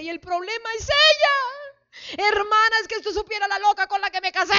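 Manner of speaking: mocking the wife's complaint. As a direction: whine it like a fed-up wife blaming her husband. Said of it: "y la esposa dice". (esposa quejándose a gritos del esposo)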